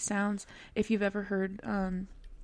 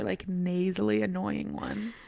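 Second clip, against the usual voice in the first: ordinary delivery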